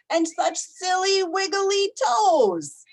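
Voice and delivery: playful sing-song lift; silly voice. Acted. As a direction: make the toes sound funny. Story read to children, playful.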